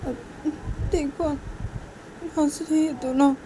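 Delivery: high little tattling voice; acted high-voiced complaint; complaining Soti. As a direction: pitch it up to tell on them. Soti complains they are laughing.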